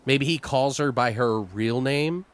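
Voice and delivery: ordinary talk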